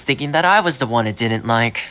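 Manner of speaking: usual speaking voice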